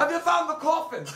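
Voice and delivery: mocking a melodramatic old man; hammed-up old man. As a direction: ham up the spooky old hunter. Mimics old man's dramatic question.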